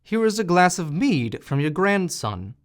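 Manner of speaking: landlord yelling at full voice; loud shout. A narrator's reading. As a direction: bellow it so a deaf woman could hear. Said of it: "shouted the landlord as loud as he could".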